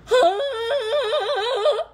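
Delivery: incredulous high laugh; high shrieking laugh. Real, not acted. Laughing at his pimping claim.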